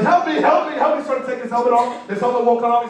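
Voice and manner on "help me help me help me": high, loud frantic cry for help; trapped man pleading in a gorilla suit; panicked plea. Retold or imitated. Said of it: "He's like".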